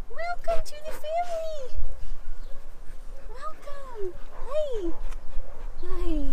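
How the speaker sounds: high-pitched dog-mom squeal; excited pet talk. Real, not acted. Gushing at dog.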